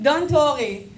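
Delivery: words spilling through a real laugh; giggling don't worry. Real, not acted. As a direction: laugh while reassuring. Reading her own text message.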